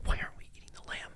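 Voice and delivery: put-on fast breathless whisper of a puzzled kid; hushed, hurried childhood question; child's whisper. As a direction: whisper it like a confused child at the table. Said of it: "I'm like".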